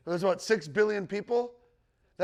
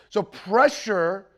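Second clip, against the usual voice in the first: pressure drawn out slowly and loud; emphasized pressure; 2 semitones higher, 9 dB louder, much slower than usual. Real, not acted. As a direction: lean hard on pressure, stretching it out. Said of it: "I emphasize that word".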